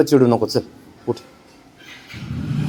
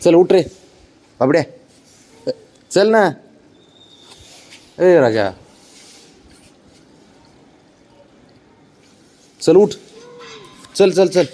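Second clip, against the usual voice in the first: higher, coaxing voice to the dog; coaxing pet talk; 4 semitones higher than usual. Real, not acted. Owner coaxing sulking dog Babdya to get up, higher coaxing pet talk. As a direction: sweet-talk the stubborn dog up.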